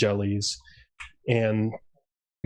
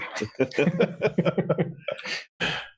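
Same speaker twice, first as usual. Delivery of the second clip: rolling, high-pitched laughter; long amused laugh; 7 semitones higher than usual. Real, not acted. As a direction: laugh at your own joke for a while. Laughing at the sarcasm joke.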